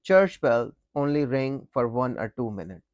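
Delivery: ordinary talk, nothing marked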